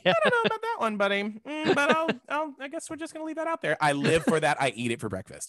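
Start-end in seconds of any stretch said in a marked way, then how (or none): 0.0-3.8 much higher, fast, hesitant mock voice; mock polite doubt. Stranger too polite to question a mispronunciation, much higher and fast. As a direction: play the puzzled stranger who won't say it.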